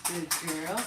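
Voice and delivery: ordinary talk, nothing marked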